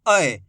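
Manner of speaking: "अ" as a falling "a-ey" glide; emphatic "ai" sound. Shown as a demonstration. Teacher showing the vowel alone, with emphasis. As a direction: sound "अ" as a strong "ai". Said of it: "इसको भी अ करके पढ़ेंगे".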